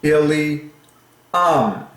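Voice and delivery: "Ili", pause, then a high "um"; last syllable split off. Shown as a demonstration.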